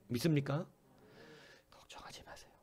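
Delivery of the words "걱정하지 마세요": soft whispered reassurance. Real, not acted. Pastor whispers comfort to a worried congregation. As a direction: lean in and whisper it like a secret comfort.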